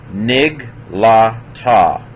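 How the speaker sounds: "Nig, la, ta" in three separate syllables; sounded out. Shown as a demonstration. Teacher modelling the Hebrew word, sounded out. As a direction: end "ta" on an open ah, no extra ha. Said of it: "you don't pronounce the".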